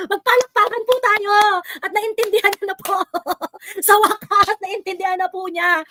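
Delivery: high, laughing mock applause call; laughing sarcasm. Host mocking commenter with fake applause, laughing. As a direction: laugh through the fake celebration.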